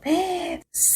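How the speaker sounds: a quick breathy gasp of shame; sharp gasp. Acted. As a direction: catch your breath in embarrassment. Ashamed narrator gasps. (نفس بریده از شرم)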